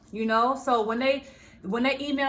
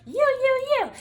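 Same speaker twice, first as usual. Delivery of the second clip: much higher and louder nagging chant of you; mocking a blamer, chanting you, much higher and louder; mocking finger-pointing; 13 semitones higher, 6 dB louder than usual. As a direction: jab each you high and shrill like blaming. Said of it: "keep pointing the finger".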